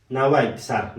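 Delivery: ordinary delivery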